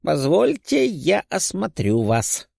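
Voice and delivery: slow old physician's put-on voice; elderly doctor. A narrator's reading. Old doctor asking to examine the patient, slow and elderly.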